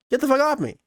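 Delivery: high, loud retold snap; mock yell. Boy yelling at the unseen thing holding his leg, high and loud. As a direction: yell it as if something grabbed you. Retold inner shout at grabber.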